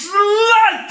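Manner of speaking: drawn-out, high mock yell; hammed-up insult. Retelling Fletcher insulting Miranda, hammed-up mock yell. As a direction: draw the insult out into a rising cry.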